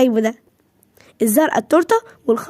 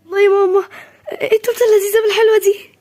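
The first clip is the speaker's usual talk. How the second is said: higher, laughing delight at the cake; squealing delight; 7 semitones higher than usual. Acted. (فرحة طفولية بصوت عالٍ وضحكة)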